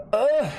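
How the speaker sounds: high, loud groan of disgust; disgusted ugh. Real, not acted. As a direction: groan in frustration. Disgusted groan at opponent play.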